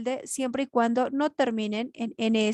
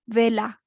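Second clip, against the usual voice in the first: Vela with "Ve" stressed; stress on first syllable; 3 dB louder than usual. Shown as a demonstration. Teacher showing where the voice hits, stress on "Ve" in Vela. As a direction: put the weight on "Ve" in Vela. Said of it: "El mayor golpe de voz".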